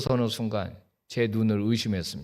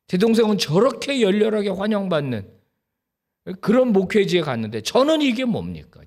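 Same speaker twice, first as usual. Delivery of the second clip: rising, louder desperate plea to God; pleading prayer; 5 semitones higher, 4 dB louder than usual. Retold or imitated. Pastor begging God in dawn prayer, loud desperate plea. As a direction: beg God to move you, voice climbing.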